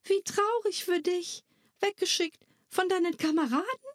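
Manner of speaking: put-on girl comforting a rejected tiny creature; pitying girl. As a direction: feel sorry for the little creature. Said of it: "bedauerte ihn Nella Della".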